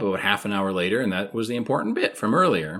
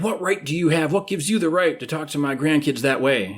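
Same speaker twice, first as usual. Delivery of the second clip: indignant grandpa; 6 semitones higher than usual. Retold or imitated. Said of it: "And he's like".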